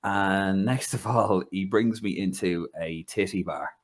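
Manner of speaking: normal talking voice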